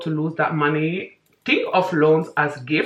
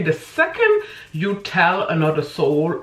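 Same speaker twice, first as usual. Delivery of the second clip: ordinary talk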